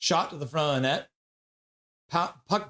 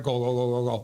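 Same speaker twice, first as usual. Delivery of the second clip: ordinary talk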